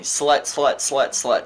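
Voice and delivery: ordinary talk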